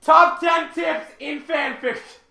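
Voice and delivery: high, loud manic yelling with cackles; unhinged shouting host laughing through it; manic Kevin shouting. Acted. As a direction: scream the tips like a deranged host. Kevin character bit, manic.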